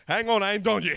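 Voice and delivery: insistent retold shout; loud protest. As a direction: call out over the music. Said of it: "and he's like".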